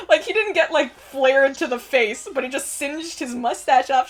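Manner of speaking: ordinary talk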